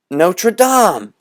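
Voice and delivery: high, loud triumphant shout. Acted. Eight boys shouting the answer, triumphant. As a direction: yell it out as a crowd. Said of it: "shouted eight boys".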